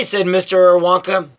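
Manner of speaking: plain reading voice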